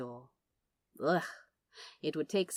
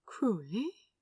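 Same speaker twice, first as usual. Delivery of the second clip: wondering; 3 semitones higher than usual. A narrator's reading.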